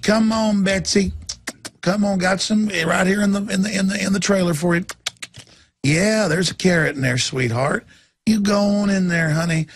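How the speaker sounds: high sweet coaxing voice; coaxing horse handler. Retold or imitated. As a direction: sweet-talk the horse into the trailer. Coaxing horse to slaughter trailer.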